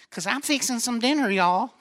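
lightly mimicked Kentucky mother-in-law; a touch of Southern belle; Southern accent. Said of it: "she got this thick accent".

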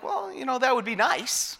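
imitated timid answer, high and soft; sheepish reply. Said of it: "And I'm like".

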